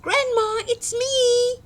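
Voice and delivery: put-on very high, bright girl's call; little girl call. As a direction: sing out to Grandma at the door. Girl calls at the door.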